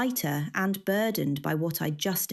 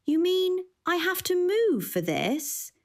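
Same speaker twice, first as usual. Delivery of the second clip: much higher, mock innocent; faux innocence; 13 semitones higher than usual. A narrator's reading. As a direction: play dumb, wide-eyed. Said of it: "wide-eyed with faux innocence".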